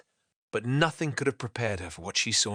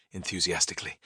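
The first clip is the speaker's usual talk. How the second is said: ordinary delivery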